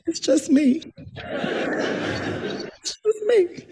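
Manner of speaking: breaking into a whispered laugh; the line dissolves into giggles. Retold or imitated. Answering the census man, laughing it off. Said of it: "And I was like".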